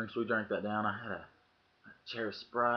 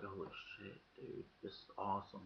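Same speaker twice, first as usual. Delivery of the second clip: hushed amazement; 14 dB quieter than usual. Retold or imitated. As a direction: let it out almost under your breath, amazed. First taste of the dip.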